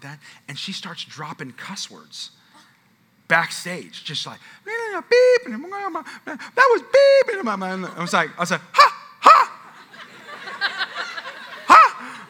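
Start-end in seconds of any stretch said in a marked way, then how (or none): 4.7-7.4 high, loud monotone beep; imitated censor bleep. Imitates censored cuss words. 8.7-12.3 loud high shocked gasps; hammed-up gasps of shock; breath-taken gasp. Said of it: "your breath gets taken away".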